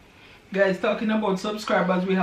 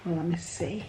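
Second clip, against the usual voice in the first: ordinary talk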